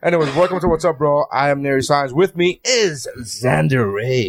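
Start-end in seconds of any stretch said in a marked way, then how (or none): 2.1-4.3 DJ voice. Acted. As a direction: announce it like a late-night DJ. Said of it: "my D J voice".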